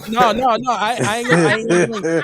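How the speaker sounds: high, loud laughing protest. Real, not acted. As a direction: refuse loudly while laughing. Laughing refusal to tell joke.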